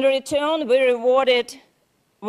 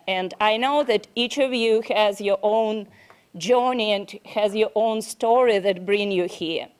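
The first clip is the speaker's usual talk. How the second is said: ordinary delivery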